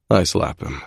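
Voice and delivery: ordinary delivery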